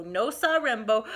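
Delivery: usual speaking voice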